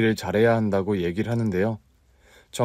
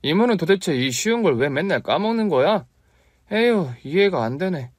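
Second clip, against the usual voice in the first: put-on higher, exasperated child nagging; nagging kid; 8 semitones higher than usual. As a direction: scold your aunt like a fussy boy. Boy nags aunt.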